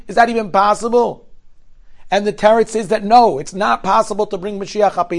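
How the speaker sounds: preaching to listeners about Mashiach; fervent preaching. Acted. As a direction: drive the point home to the congregation. Sermon lesson on Tisha B'Av.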